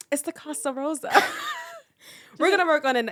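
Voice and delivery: high giggly laugh; laughing through the words. Real, not acted. Laughing at own accent.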